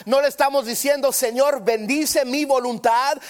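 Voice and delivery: high, loud sermon cadence; fervent preaching. Acted. Preacher teaching the church how to pray, high and loud. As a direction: preach each prayer line with rising force. Preacher contrasting kinds of prayer.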